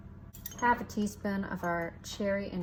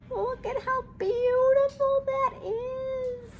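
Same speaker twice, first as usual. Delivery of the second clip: much higher, slow, squeaky-voiced admiration; squealing delight; 4 dB louder, slower than usual. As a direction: coo over it in a tiny high voice. Admiring the smooth frosting.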